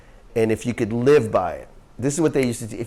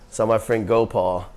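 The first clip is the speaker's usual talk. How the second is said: usual speaking voice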